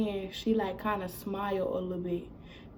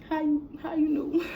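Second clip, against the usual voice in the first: higher-pitched mock tearful question; retold crying; 7 semitones higher than usual. Daughter asking mom while wiping tears, mock crying. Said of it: "so I'm crying".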